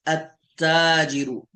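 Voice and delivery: अत्ताजिरु slow, the lam silent, ta doubled; अत्ताजिरु धीरे, लाम के बिना; Arabic sun letter demo. Shown as a demonstration. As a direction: say अत्ताजिरु slowly as a-ttaajiru, no l sound. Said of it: "तो इसमें लाम नहीं पढ़ा जाता".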